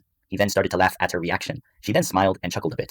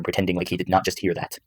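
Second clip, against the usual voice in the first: plain reading voice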